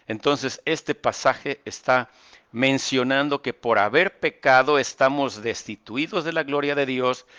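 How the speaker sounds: usual speaking voice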